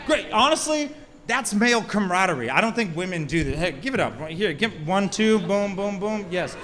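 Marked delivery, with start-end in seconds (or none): none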